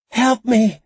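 put-on high, loud, pleading child's cry; childlike whimper. A narrator's reading. Drugged captive begs into the camera, whimpering like a child. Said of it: "with the whimper of a child".

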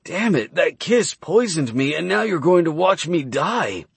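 high, strained voice in agony; pained and strained. Acted. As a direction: cry out as if dying. Dying man accusing Arya.

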